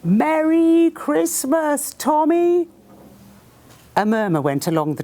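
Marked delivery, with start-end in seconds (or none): 0.0-2.7 high, slow, called from far off across the lines; chorused greeting. A narrator's reading. Germans call across no-man's-land, high and slow chorus. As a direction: stretch it out as a distant chorus. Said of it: "voices chorused".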